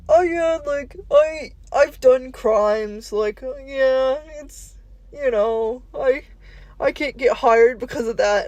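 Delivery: slow, goofy drawn-out voice; dopey slacker voice. Retold or imitated. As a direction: play it dim and unbothered. Boogie voiced admitting crimes.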